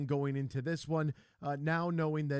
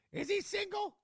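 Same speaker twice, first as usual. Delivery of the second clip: mother voice. Retold or imitated. Host voices his mother.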